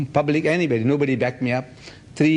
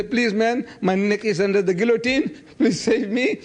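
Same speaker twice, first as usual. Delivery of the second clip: higher, hammed-up pleading for help; mock begging; 7 semitones higher than usual. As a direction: play a man begging to be saved, higher. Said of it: "I go around begging".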